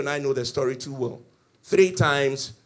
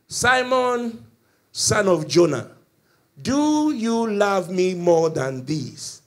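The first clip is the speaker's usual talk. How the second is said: loud, drawn-out preaching call; solemn questioning; 4 semitones higher, 7 dB louder, much slower than usual. Acted. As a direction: voice Jesus calling Peter out, slow and resounding. Jesus solemnly questions Peter.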